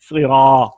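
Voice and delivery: सिरा with the रा vowel stretched; drawn-out Siraat. Shown as a demonstration. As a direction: hold the alif long in सिरा. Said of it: "ये देखिए, सिरात में रा ज़बर".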